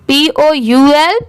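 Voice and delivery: ordinary talk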